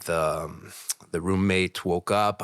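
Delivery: ordinary delivery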